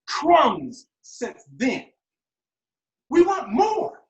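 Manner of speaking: high-pitched, rising indignation; impassioned anger. Acted. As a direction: build to a high, insistent protest.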